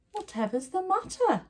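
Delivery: lightly voiced kind old woman, high; a gentle high-pitched granny asking. A narrator's reading. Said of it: "asked a kind voice".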